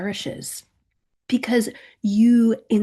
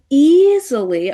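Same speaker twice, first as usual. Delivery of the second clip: "easily" drawn out with heavy emphasis; 4 semitones higher, 7 dB louder, slower than usual. Shown as a demonstration. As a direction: lean hard on the first syllable of "easily". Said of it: "emphasis on easy".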